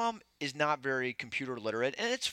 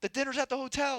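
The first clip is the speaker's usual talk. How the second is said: higher, put-on repeat of the answer; mock exasperated voice; 10 semitones higher than usual. Groom repeating the dinner answer, mock exasperated. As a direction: say it again in a silly voice, fed up.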